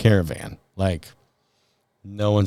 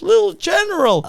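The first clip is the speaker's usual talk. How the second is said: high, loud victory whoop; celebrating cheer; 4 dB louder than usual. Retold or imitated. Trooper cheering the car from afar, high and loud. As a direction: cheer it with arms up. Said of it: "puts his arms up in celebration".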